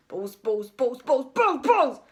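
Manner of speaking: muttering, building. A narrator's reading. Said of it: "he carried on muttering".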